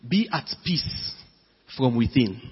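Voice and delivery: hushed, unhurried reassurance; calm. Real, not acted. Preacher urges listeners to inner peace, calm and soft.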